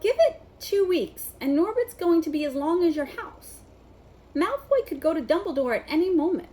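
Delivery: high, loud urgent warning. A narrator's reading. Harry warning Hagrid about the growing dragon, loud and urgent. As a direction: say it loud and worried. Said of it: "Harry loudly".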